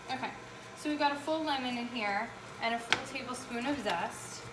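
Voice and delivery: normal talking voice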